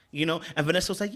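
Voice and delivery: ordinary delivery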